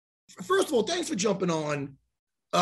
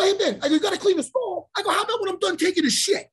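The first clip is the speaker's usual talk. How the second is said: exasperated; 11 semitones higher, 4 dB louder than usual. Retold or imitated. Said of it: "So I go".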